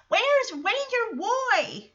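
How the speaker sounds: put-on high, drawn-out child's question; imitated little kid. Teddy asking after Ranger Roy, imitated little kid. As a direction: put on a small child's voice, stretching the words.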